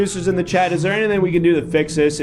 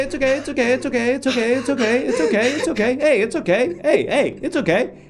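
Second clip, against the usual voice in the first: soothing; 10 semitones higher than usual. Acted. Calming a panicking co-performer.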